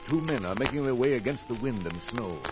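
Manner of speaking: usual speaking voice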